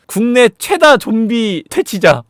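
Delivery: high, loud, slow proclamation; proclaimed sign text. Retold or imitated. Reads first love's zombie-hunter sign aloud, slow mock proclamation. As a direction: read the boast out like a banner.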